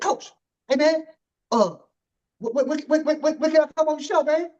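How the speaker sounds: high, fast and pushy asking; eager coach voice. Retold or imitated. Host imitating the coach begging to come on the show, high and fast. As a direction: rattle it off like an impatient coach. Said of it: "he said".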